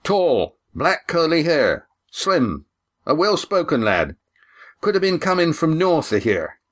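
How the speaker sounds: high-pitched seaman describing a shipmate; sailor calling back. A narrator's reading. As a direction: call the description out item by item. Voices sailor answering through door.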